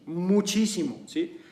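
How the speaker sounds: ordinary talk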